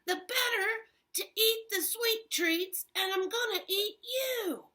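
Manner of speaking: high, loud gleeful wolf; pouncing wolf. Acted. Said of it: "and he said".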